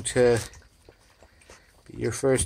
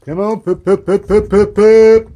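high pup-pup call, building louder; calling puppies; 8 semitones higher, 9 dB louder than usual. Real, not acted. Owner urging the puppies to come, high rapid call. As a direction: repeat it quick and high, rising to a loud call.

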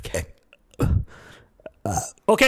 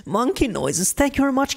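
normal talking voice

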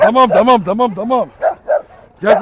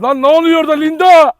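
startled, pitched-up yell; surprised shout; 10 semitones higher, 10 dB louder than usual. Real, not acted. Owner shocked as Rocky goes for Linda, surprised shout. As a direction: blurt it out in shock.

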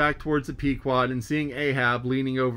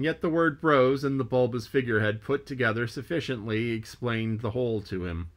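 ordinary delivery, nothing marked

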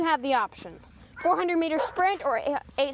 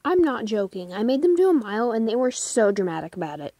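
ordinary talk

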